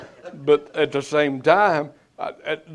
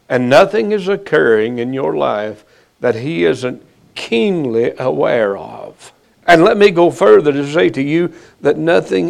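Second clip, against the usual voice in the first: loud, urgent preaching that builds; building sermon; 6 dB louder than usual. Acted. Pastor assuring his flock, loud and urgent. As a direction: press the promise hard on the congregation.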